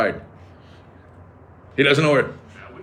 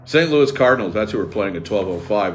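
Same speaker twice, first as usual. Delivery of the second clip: ordinary talk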